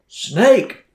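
sudden high, loud alarm yell; shouted warning. Retold or imitated. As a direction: yell it out to warn a runner behind you. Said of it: "loud scream ... more of an, an announcement".

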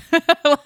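a sudden high laugh at herself; high burst of laughter. Real, not acted. Podcast host laughing at her own zombie thought, high burst of laughter. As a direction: laugh out loud at how dumb it was.

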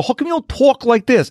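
lightly put-on New York City accent. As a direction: imitate people expecting a Bronx accent. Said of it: "that's New York City. That's the Bronx".

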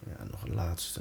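very soft, slow breathing cues; soft spa whisper. Acted. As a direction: hush it down to guide the breath. Counts down the breaths.

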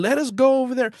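high, loud, insistent plea; acted high, pushed begging; begging demons. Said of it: "They begged him again and again".

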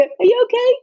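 high-pitched imitated call through a window; shouted question. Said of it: "shouted down the window".

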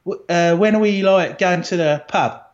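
put-on cheeky London cabbie accent. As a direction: sound like a London cab driver. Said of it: "speaking like a cabbie".

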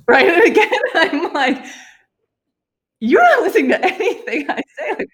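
giggling through a mock complaint; laughing at her own exasperation. Real, not acted. Laughing at the memory.

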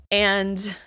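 ordinary talk

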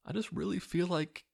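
airy high-pitched impression of a devout wife; imitating movie wife. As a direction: play the earnest wife in a mocking falsetto. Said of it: "and she's like".

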